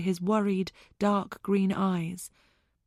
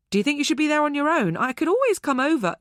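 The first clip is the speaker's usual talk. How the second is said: higher, fast worried friend; concerned Zoe; 7 semitones higher, 4 dB louder, faster than usual. A narrator's reading. Zoe anxiously offering to fly over to help.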